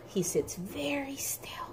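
whispered, hushed and still. Acted. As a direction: whisper it to show sitting still. Hushed for a sitting-still line.

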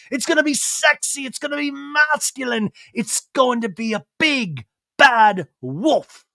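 high, emphatic circus-style promo; hammed-up sales pitch in a high put-on voice; Lisa Vanderpump. Lisa hyping her restaurant, circus-promo impression. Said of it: "big Barnum and Bailey's promotional".

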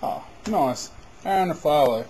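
ordinary delivery

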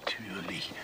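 whispered aside. Real, not acted. Director whispering his answer to the actress. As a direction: breathe it with no voice.

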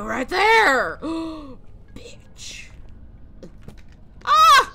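sudden loud startled yelling; jump-scare yelp; startled shout. Real, not acted. Ghost throws things at her.

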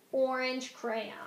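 usual speaking voice

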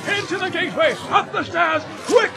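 high, loud shouted commands; alarmed wizard yelling orders; urgent shout. A narrator's reading. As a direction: shout them out of danger. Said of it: "shouted Gandalf".

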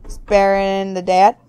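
ordinary talk, nothing marked

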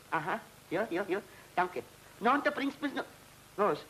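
ordinary talk

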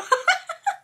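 sudden very high squeal of laughter; high giggle. Real, not acted. Laughing at own studio joke.

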